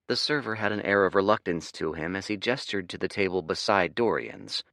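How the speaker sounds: usual speaking voice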